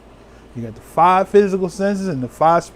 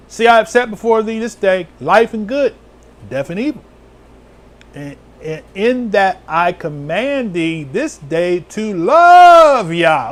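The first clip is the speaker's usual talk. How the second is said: raised, slow, solemn scripture reading; 5 semitones higher, 5 dB louder than usual. Acted. Preacher reading Deuteronomy to the flock, raised and slow. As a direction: read it out like the pulpit Bible.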